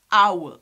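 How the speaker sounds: "our" loud, as "ow-uh"; triphthong pronunciation. Shown as a demonstration. Teacher confirming it is with U, "our" as "ow-uh". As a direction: glide "our" through U into schwa. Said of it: "we say".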